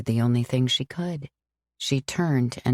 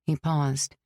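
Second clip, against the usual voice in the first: plain reading voice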